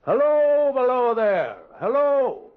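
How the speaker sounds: very high, loud repeated hail; drawn-out holler to a distant man; calling out. Acted. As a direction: shout it down from the embankment. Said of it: "I called again".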